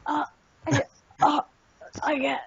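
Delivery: hammed-up dying gasps; mock last words, broken by pauses; pretend dying. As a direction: fake a sudden death, haltingly. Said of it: "just immediately die".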